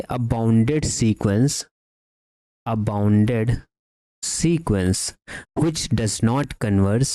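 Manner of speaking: measured, slow delivery for note-takers; slow dictation. Real, not acted. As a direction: dictate word by word, with long pauses. Dictating the second result.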